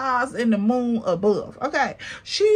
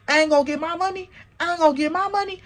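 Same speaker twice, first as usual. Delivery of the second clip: fast gloating taunt; 5 semitones higher, much faster than usual. Retold or imitated. Repeated taunt quote.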